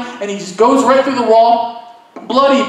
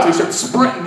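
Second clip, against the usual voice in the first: ordinary delivery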